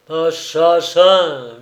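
slow, loud drawn-out curse; howled. A narrator's reading. Old sailor cursing the sunk pirates, howled insult. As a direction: hurl the word at the wreck. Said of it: "hurlait Salaun".

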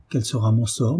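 ordinary delivery, nothing marked

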